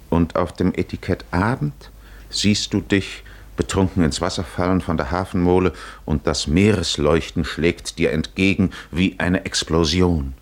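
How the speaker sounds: usual speaking voice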